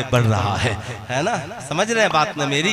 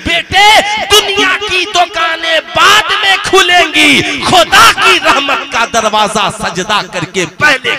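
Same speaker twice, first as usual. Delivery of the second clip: high, loud preaching, shouted out; fiery preaching; 9 semitones higher, 8 dB louder than usual. Acted. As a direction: thunder the sermon at the faithful. Preaching at full force.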